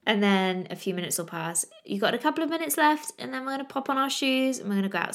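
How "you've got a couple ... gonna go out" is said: cheery prep voice. Retold or imitated. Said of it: "I'm doing all the prep work".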